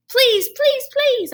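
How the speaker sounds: very high, loud hamster squeak; squeaky plea. Acted. Hamster begging the janitor to stay. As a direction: beg in a tiny squeak. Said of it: "I squeaked".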